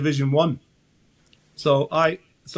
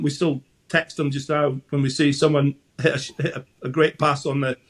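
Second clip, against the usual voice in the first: ordinary talk, nothing marked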